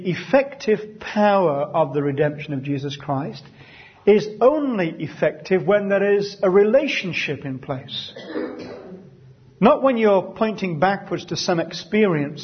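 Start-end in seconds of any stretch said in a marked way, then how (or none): none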